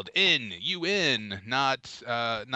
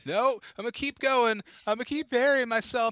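high, hammed-up comic voice; mock defiance; 9 semitones higher, faster than usual. Refusing to end a failing bit, mock-defiant. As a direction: dig in stubbornly, pitched way up.

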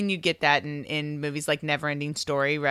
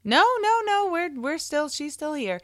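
very high, exaggerated "no, no, no"; mock reassurance; 12 semitones higher than usual. Viewer correcting their own confusion over the girl, mock relieved. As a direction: play up a viewer's comic double-take. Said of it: "I kept thinking".

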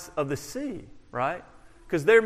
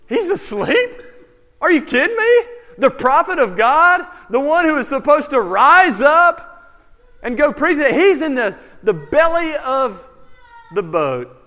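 high, loud disbelief; incredulous; 7 semitones higher, 7 dB louder than usual. Real, not acted. Preacher to congregation, loud high astonished disbelief. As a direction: cry out in amazed disbelief at Jonah.

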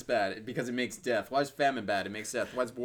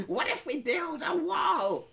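laughing shout; 12 semitones higher, much slower than usual. Acted. Laughing shouted slow joke.

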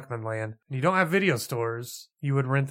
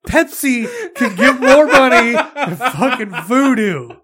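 loud, high, slow laughing outrage; laughing rant; 8 semitones higher, 10 dB louder, slower than usual. Real, not acted. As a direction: rage through laughter. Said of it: "I see the rage in your eyes".

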